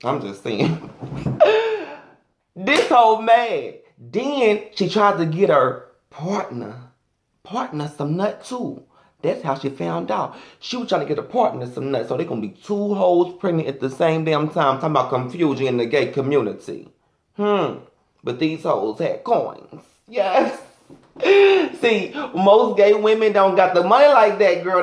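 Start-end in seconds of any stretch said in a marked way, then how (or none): 0.9-1.9 sudden high-pitched laugh. Real, not acted. Laughing at own joke. 21.2-21.7 a louder, high laugh; laughing out loud at her own joke. Real, not acted.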